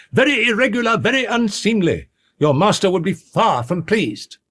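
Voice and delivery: high-pitched peevish scolding. A narrator's reading. Lawyer rebuking the servants on the master's behalf, peevishly. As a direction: scold fussily, far up in pitch. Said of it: "said the lawyer peevishly".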